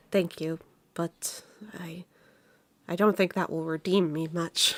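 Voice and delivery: soft, halting, low-energy thanks; hesitate, then answer very quietly, like a sigh; sighing, hesitant. Acted. She thanks her for the offer but doubts redemption, sighing softly.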